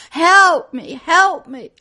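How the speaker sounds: loud, high, pleading screams; desperate cries for help. Retold or imitated. Preacher voicing the trapped souls crying for help. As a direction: cry out like souls trapped in flames. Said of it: "I could hear the screams and the cries".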